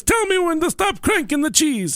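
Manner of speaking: high cartoon Italian voice; high, sing-song Italian waitress; jolly italian pizza chef. Voicing Olive Garden waitress.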